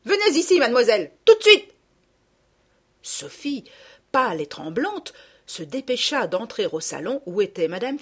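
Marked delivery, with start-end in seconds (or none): 0.1-1.7 much higher, much louder angry command; furious order. Acted. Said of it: "la voix furieuse de madame Ficquini". 3.0-3.6 scared whispered name; a terrified girl hearing her stepmother; frightened whisper. Acted. Frightened whisper as she is summoned.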